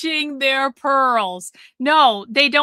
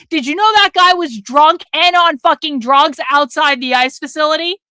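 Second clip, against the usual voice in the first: ordinary delivery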